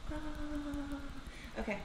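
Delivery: normal talking voice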